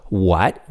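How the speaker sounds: WHAT sweeping upward; rising tone. Shown as a demonstration. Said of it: "your tone naturally rises".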